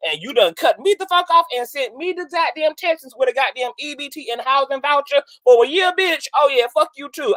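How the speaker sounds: hammed-up outrage at being cut off; mock indignant Kelsey.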